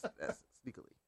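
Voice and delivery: whispered playfully. Pretend. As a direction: breathe it out in a whisper. Repeating the funny word.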